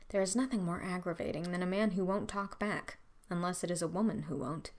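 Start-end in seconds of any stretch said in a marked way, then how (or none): none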